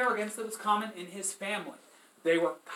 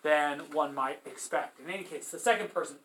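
ordinary delivery

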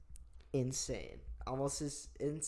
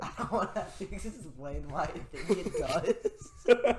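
giggling through the words; laughing; 3 semitones higher, 9 dB louder than usual. Real, not acted. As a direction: laugh while admitting it. Laughing through embarrassed answer.

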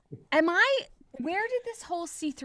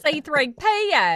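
put-on Southern accent; 5 semitones higher, 7 dB louder than usual. Co-host teasing about a Southern thing, Southern accent. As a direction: say it with a hammed-up Southern twang. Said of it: "maybe it's a Southern thing".

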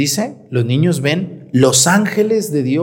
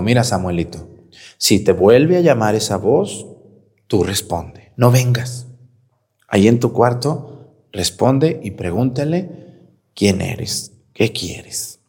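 put-on old priest's advice; 6 semitones lower than usual. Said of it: "le dijo".

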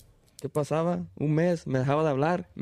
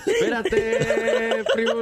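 much higher and louder, laughing; laughing protest; 7 semitones higher, 5 dB louder than usual. Real, not acted. Teased by friends about his love life, laughing protest. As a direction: beg them to wait while cracking up.

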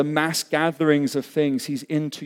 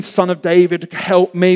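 cry for help; 4 semitones higher, 7 dB louder than usual. Acted. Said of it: "We've seen the cries".

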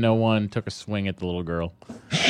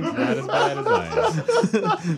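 laughing; much slower than usual. Real, not acted. Joking confession laughing.

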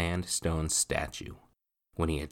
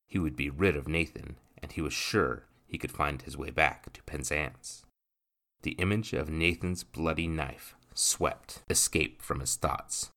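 ordinary delivery

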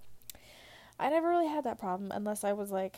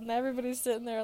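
ordinary delivery

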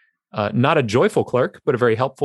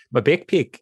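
put-on New Zealand accent; 2 semitones higher than usual. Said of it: "that's more New Zealand".

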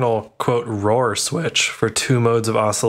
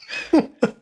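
high-pitched giggle; squeaky little giggle; squeaky laugh; 13 semitones higher than usual. Real, not acted. Giggling after joke.

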